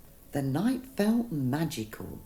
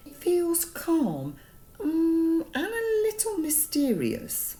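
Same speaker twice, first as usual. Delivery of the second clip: Mister Mango voice; 13 semitones higher, 4 dB louder than usual. Acted. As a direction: lift way up into a light character voice.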